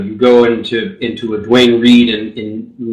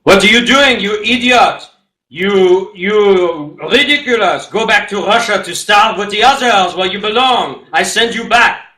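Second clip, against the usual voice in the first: imitating an angry boss; 7 semitones higher, slower than usual. Boss berating a waiter in mock fury. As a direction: bark at the waiter like a tiny tyrant. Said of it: "I could quote something he used to, to say".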